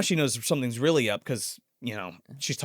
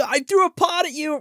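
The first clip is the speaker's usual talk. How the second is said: put-on taunting child; 13 semitones higher, 5 dB louder than usual. Mock line as taunting son.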